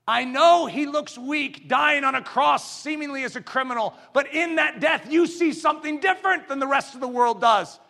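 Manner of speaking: high, loud, impassioned pulpit cry; full-force preaching. Real, not acted. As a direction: build to a triumphant shout.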